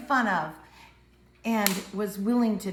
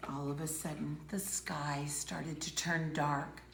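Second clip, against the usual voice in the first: hushed; 5 semitones lower, 10 dB quieter than usual. A narrator's reading. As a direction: hush the room as darkness falls. Ominous storytelling.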